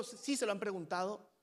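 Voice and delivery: usual speaking voice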